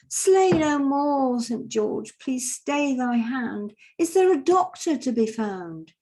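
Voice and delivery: high, loud, very slow stately appeal; Father Christmas pleading. Retold or imitated. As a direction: proclaim it slowly like a grand old peacemaker. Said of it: "And Father Christmas goes".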